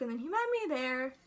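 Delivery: ordinary delivery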